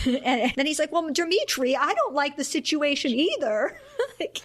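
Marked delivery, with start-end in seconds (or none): 0.9-3.8 higher, placating whine; exaggerated phone reasoning, pitch lifted; talking to a child. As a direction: mimic pleading patiently with a child. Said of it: "speaking a lot to him like he's a child".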